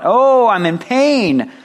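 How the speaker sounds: loud, high, drawn-out wail; hammed-up agonized cry; mock cry of pain. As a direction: cry out in exaggerated agony, stretching the words. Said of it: "he doesn't cry out".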